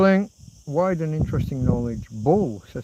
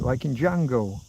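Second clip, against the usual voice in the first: ordinary talk, nothing marked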